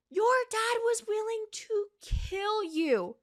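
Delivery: high, slow voice close to crying; near tears. Real, not acted. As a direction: let it crack with outrage. Ranting at Zuko.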